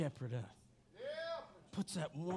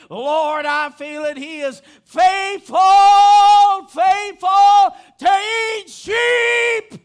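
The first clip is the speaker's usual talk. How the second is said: preaching that swells to slow, held-out shouts; fervent preaching; 11 semitones higher, 19 dB louder, much slower than usual. Real, not acted. Preacher feeling the shepherd is faithful, rising fervent preaching. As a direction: build to a drawn-out cry of praise.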